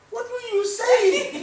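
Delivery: squeaky high, slow, laughing "what?"; loud high-pitched bewildered question; confused yelp. Retold or imitated. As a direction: play it baffled and drag it out, laughing. Retold confusion at mom in car.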